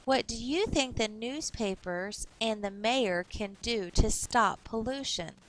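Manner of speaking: ordinary talk, nothing marked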